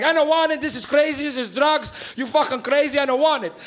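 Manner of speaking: high-pitched imitation of an agitated immigrant mom; refusing mother. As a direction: protest like a scandalized mother. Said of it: "And her being like".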